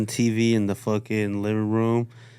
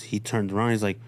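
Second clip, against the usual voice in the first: usual speaking voice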